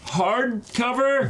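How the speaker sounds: high, slow drawn-out delight; excited drawn-out reveal; thrilled. Real, not acted. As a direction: savour the word excitedly. Thrilled at the hardcover.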